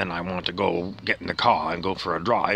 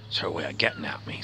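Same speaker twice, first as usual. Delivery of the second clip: whispered aside; 3 semitones lower, 7 dB quieter, faster than usual. Real, not acted. Aside about his wife.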